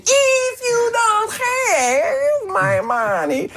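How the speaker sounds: very high, very slow, stretched-out threat; hammed-up Malkovich line. Matt Damon mimicking Malkovich's sudden switch into action, very high and slow. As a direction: ham it up, drag every word. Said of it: "we're talking like this, and suddenly the action".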